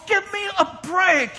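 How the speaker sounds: exasperated preacher scoff. Real, not acted. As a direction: brush it off with a shout. Scoffing at complainers.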